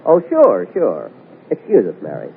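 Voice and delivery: ordinary talk, nothing marked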